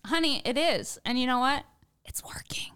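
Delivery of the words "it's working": conspiratorial whisper; breathy secret whisper. Real, not acted.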